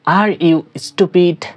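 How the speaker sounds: slow, carefully spaced question; teacher pronouncing slowly; slow demo. Shown as a demonstration. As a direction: model it slowly for learners. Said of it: "তুমি কি বোকা?".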